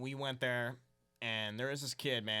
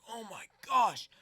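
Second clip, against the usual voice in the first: amazed gasp; 10 semitones higher, 7 dB louder than usual. Retold or imitated. Seeing huge spotty fish.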